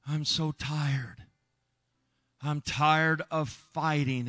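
very slow weary words; exhausted trapped man, slow; tired. Retold or imitated. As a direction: drag them out, worn out.

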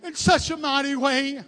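pleading prayer. Real, not acted. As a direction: plead gently, lingering on the phrases. Leads softer altar prayer.

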